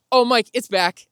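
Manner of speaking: high, loud burst of relieved joy; excited relief. Retold or imitated. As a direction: blurt it out, suddenly thrilled. Watch miles restored, relief.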